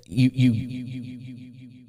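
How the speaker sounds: stuttering echo effect. Shown as a demonstration. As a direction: repeat it through an echo. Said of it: "all kinds of very cool things".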